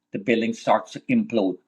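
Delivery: normal talking voice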